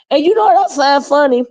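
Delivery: high excited voice; lively and amused. Real, not acted. Excited laughing storytelling.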